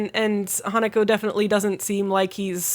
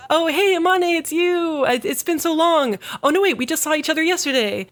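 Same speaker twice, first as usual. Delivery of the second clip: high, bright-eyed mock cheer; cheery Tsukasa; 8 semitones higher than usual. Tsukasa greeting his brother Amane, high and cheery. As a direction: greet him like an old pal, breezily. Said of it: "Tsukasa's like".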